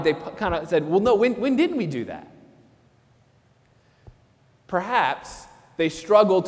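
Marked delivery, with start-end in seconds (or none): 0.9-2.3 raised, prideful protest; proud denial. Acted. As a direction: bristle at being judged. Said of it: "out of a little bit of a pride".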